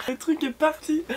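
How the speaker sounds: high, startled exclamation; surprised. Real, not acted. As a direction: blurt it out in shock. Drink bottle pops open.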